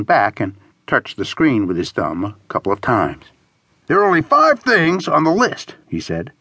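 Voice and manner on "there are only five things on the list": higher character voice, reporting the options; announcing friend. A narrator's reading. Friend reading attractions from phone, announcing. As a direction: announce the short list in a higher voice. Horace reads list aloud.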